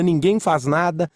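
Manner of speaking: ordinary talk, nothing marked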